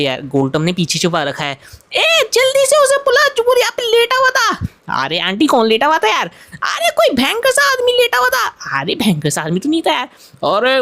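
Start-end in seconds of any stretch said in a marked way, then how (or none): 1.9-4.7 bossy aunty voice. Acted. Aunty orders a search. 6.6-8.8 dramatic aunty voice. Acted. Aunty describes the intruder.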